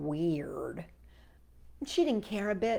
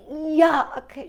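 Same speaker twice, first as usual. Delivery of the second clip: disgusted yuck; 6 semitones higher, 8 dB louder than usual. A narrator's reading. Camilla pretends to hate beans.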